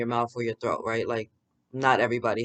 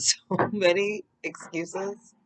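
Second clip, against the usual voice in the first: laughing; 7 semitones higher, much slower than usual. Real, not acted. Laughing then repeats plainly.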